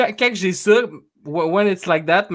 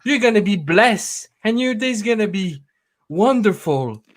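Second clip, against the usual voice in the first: higher, sing-song churchy message voice; imitating religious voicemail; 5 semitones higher than usual. Caller mocking an old woman's religious voicemail, sing-song. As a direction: bless the caller in a lilting tone. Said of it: "It's like".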